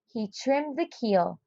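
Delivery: ordinary delivery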